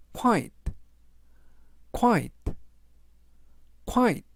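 "quiet" said three times with a short KWAI start; pronunciation drill. Shown as a demonstration. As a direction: say "quiet" briskly, one take at a time. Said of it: "라고 발음하시면 되겠고요". ("quiet"를 세 번 짧게 발음 시범)

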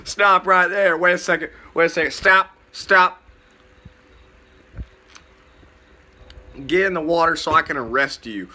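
hammed-up spring police shouting stop; mock police officer. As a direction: play a pompous cop yelling at swimmers. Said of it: "the spring police".

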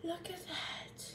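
soft slow whisper. Real, not acted. Whispering over the pan so as not to disturb. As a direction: breathe it out softly.

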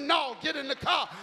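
insistent. Retold or imitated. Bishop repeating an insistent order.

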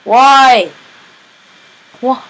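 loud, high cries of despair; real gamer outrage, loud and high; dismayed yelling. Real, not acted. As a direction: yell at the screen as you die. Killed by zombies, dismay.

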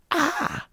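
very high mock shriek of a bottle opener face; cartoon face scream. Describing the opener's face, a high mock "Ah!". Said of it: "the one that looked like it's going".

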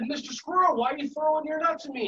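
playfully indignant question; mild mock complaint. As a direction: plead with a naughty squirrel. Voices complaint to squirrel in story.

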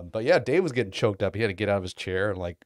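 usual speaking voice

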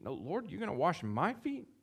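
shocked protest; incredulous. Acted. Preacher voicing Peter's shocked protest to his Lord.

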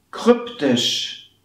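"kryptisch" with a short Ü, almost Ö; short Ü model. Shown as a demonstration. Teacher modelling the short Ü in "kryptisch". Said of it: "Ö, hm?".

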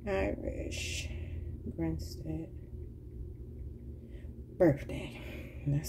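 very soft, words spaced far apart; half-voiced, distracted reading aloud; absent self-murmur. Real, not acted. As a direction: murmur it to yourself while looking something up. Reads out a search to self.

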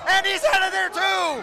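high, loud play-by-play cry; excited shout. Real, not acted. Commentator as another wrestler is thrown out, excited shout. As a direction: jump up in pitch as it happens. Live call of an elimination.

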